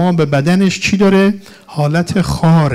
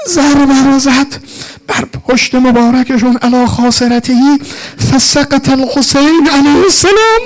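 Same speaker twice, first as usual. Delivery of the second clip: high, loud, tearful lamentation; anguished martyrdom narration, crying out; wailing rowzeh; 12 semitones higher, 7 dB louder than usual. Real, not acted. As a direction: preach at full force through weeping. Climax of mourning sermon.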